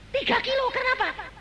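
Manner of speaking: high punchy question; exclaimed. Acted. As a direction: fling it high to the crowd. Rhetorical punchline.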